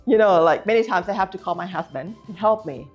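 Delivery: ordinary delivery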